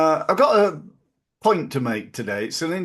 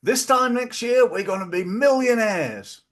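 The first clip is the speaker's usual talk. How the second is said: much higher, louder cheeky market-trader boast; Del Boy catchphrase; 10 semitones higher, 3 dB louder than usual. Retold or imitated. Host imitating Del Boy promising riches to listeners, high loud boast. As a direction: crow it like a wide-boy dreamer. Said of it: "Del Boy said".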